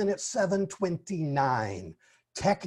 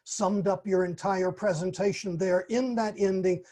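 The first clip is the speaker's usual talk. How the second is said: normal talking voice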